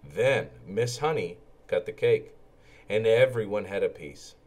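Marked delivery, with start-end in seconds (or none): none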